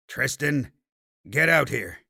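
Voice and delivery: plain reading voice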